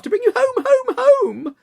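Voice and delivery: put-on very high, loud, singsong child repeating home; little girl begging her brother home, gleeful and high. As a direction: chant home like an overjoyed little sister. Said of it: "bending down to laugh".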